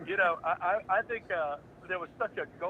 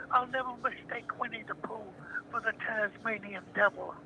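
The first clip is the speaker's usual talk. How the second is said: character voice; 6 semitones higher than usual. Retold or imitated. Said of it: "I'm not gonna".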